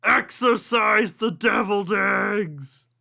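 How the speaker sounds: ghost wail. Acted. Ghost wailing.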